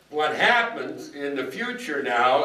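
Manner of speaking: ordinary talk, nothing marked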